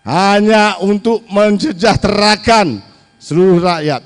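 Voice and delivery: drawn-out, weighty words; slow emphatic oration. Real, not acted. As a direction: stretch each word for emphasis.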